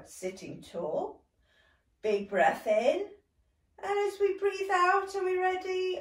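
ordinary talk

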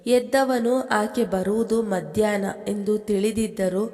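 ordinary delivery

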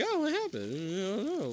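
clueless bystander. Acted. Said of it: "He's just standing like".

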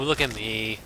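low, soft, falling away; subdued repeat. Acted. Player echoing the earlier call, low and subdued. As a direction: let it sink low and quiet.

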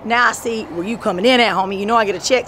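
ordinary talk

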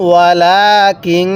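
very slow chant with the ghunnah held long; slow nasal-held recitation. Shown as a demonstration. Teacher models the ghunnah for students, slow chant. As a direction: hum the nun through the nose, drawn out. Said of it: "গুনাহ করব কেন?".

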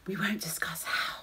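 cheeky whisper; hushed cheeky aside. Acted. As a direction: drop to a knowing whisper.

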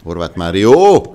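loud rising call of the name; excited sports commentary. Real, not acted. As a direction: shout the player's name as he breaks. Live football match commentary.

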